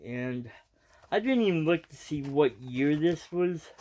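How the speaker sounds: ordinary talk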